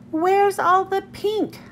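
high, slow, drawn-out question; puzzled wondering. A narrator's reading. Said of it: "I wondered".

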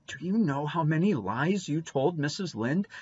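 put-on high, lilting girlish reproach; reproachful. A narrator's reading. Dora confronting Davy alone after dinner, reproachful. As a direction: chide him in a high little voice. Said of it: "asked Dora reproachfully".

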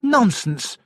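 high-pitched indignant exclamation; angry cry. A narrator's reading. Lady objects to the steward's order, angrily. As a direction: dismiss it crossly in a high voice. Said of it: "cried Lady Horbury angrily".

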